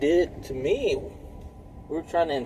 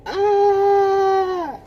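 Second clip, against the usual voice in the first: loud, high sustained scream; imitated scream; 6 dB louder than usual. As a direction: hold a piercing "Ah!" in imitation of their screaming.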